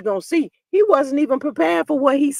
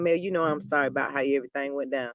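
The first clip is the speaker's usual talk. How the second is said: lower, softer and fast, a mock humble apology; 10 semitones lower, 10 dB quieter, faster than usual. Marceau apologizing to Mel, soft and low. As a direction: sheepishly say sorry to Mel, imitated. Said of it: "I can see Marceau saying".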